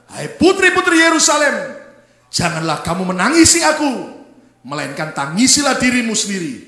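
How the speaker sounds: very loud scripture proclamation; ringing, forceful pulpit reading; preach at full force. Acted. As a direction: thunder the verse out to the whole church.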